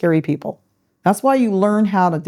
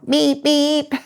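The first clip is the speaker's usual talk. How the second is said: horn imitation; 9 semitones higher than usual. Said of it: "And I go".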